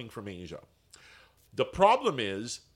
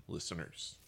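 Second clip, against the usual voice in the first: breathy whispered repeat; whispering; 10 semitones lower, 16 dB quieter than usual. Pretend. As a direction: drop to a whisper.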